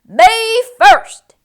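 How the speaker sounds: high, loud "Me first!"; pushy piglet shout; pushy pig call. A narrator's reading. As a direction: ring out across the cafeteria.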